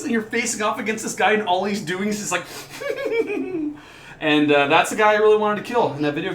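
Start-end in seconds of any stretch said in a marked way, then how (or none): 2.5-3.8 high, quiet quivering giggle; quivering orc laugh. Retold or imitated. Orc facing the player, high quivering creepy laugh. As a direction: shiver the laugh out, unsettling. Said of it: "disturbing, quivering laugh".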